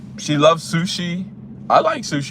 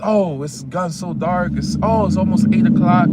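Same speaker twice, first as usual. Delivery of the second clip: worried date; 4 semitones higher, 3 dB louder than usual. Retold or imitated. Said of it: "she realized".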